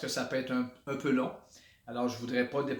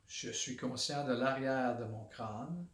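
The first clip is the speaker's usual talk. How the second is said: guided body scan; 3 semitones lower, 6 dB quieter than usual. Shown as a demonstration. Instructor leading listeners through a body awareness exercise, calm meditation voice. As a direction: guide them inward quietly, one body part at a time.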